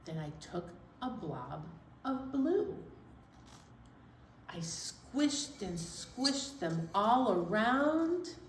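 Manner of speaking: ordinary delivery, nothing marked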